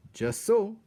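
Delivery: high, loud satisfied exclamation; pleased Threadmaster. A narrator's reading. Threadmaster watching the happy feast, high proud approval. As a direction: pronounce it with proud delight. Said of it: "said Threadmaster Hovis, smiling".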